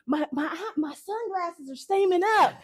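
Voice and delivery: high, hammed-up choked-up complaint; high-pitched mock exasperation; flustered friend. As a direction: imitate a friend getting emotional, half laughing. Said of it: "And Chris is like".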